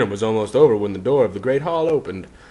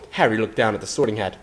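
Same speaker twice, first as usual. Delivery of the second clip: usual speaking voice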